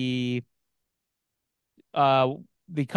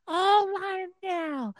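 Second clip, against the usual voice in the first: very high, soft falsetto pop-star impression; 10 semitones higher, 6 dB quieter, slower than usual. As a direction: coo it high and softly, mock innocent. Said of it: "And [name] was like".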